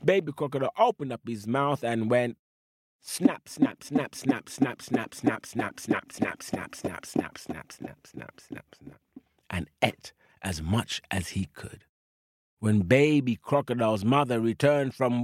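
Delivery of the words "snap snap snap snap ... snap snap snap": chomping away, trailing off to a whisper; snapping, fading to whisper. Acted.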